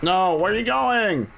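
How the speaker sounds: high, alarmed plea; panicking gamer. Real, not acted. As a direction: yelp it as the fight falls apart. Partner runs off in boss fight.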